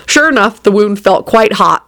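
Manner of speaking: plain reading voice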